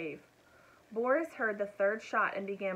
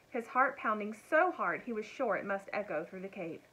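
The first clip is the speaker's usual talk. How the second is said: plain reading voice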